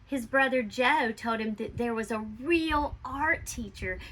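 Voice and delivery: usual speaking voice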